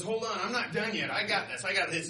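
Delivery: loud, high, insistent bluster; blustery North Wind. Acted. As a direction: insist loudly that you're not beaten. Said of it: "he's really blustery".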